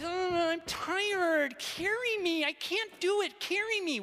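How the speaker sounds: high, slow whining plea; whiny child. Retold or imitated. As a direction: whine it like a tired kid at the park. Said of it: "in a very whiny voice".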